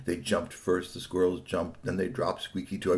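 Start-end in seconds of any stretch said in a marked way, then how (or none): none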